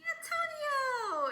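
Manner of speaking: high, drawn-out happy announcement; excited reveal. Real, not acted. Revealing the favorite character to viewers, excited. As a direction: sing out the name, slow and high.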